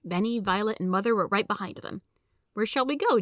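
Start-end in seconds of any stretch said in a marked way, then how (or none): none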